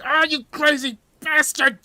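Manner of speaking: high, loud pained scream; screaming after being stabbed. Acted. Stabbed hostage screaming at the narrator. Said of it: "He screamed".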